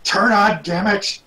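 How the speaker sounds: frustrated. Pretend. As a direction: snap at the machine. Frustrated at cogitator.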